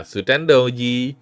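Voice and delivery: ordinary talk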